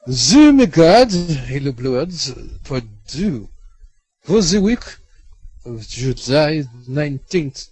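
slow, high announcer intro. Acted. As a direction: boom it like a show announcer. Podcast intro in put-on voice.

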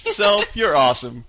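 high, loud self-congratulation; mock self-praise. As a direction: praise yourself, hammed up.

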